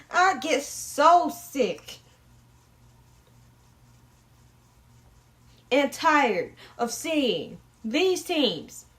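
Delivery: very slow, dragged out; drawn-out complaint. Real, not acted. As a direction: stretch every word in exasperation. Complaining about teams.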